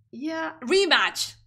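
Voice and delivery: relieved realization. Real, not acted. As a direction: land it like you finally remembered. Finally recalls the forgotten word.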